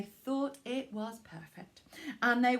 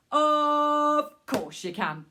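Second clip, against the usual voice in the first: booming kind man; 4 semitones higher, 6 dB louder, slower than usual. Acted. Man answers.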